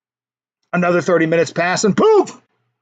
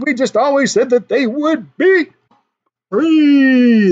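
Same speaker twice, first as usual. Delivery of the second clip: playful shout; 7 semitones higher, 3 dB louder, slower than usual. Acted. Mock announcement of free jokes.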